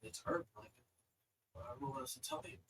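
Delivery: soft, tired doctor voice; hushed and resigned; quiet helpless doctor; weary doctor. Acted. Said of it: "they're just like".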